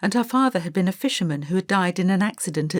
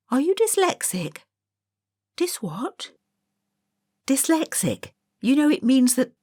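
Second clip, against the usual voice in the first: put-on curious girl asking; 7 semitones higher than usual. Lucy and Mary exchange.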